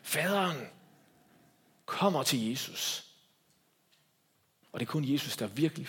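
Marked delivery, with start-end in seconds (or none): none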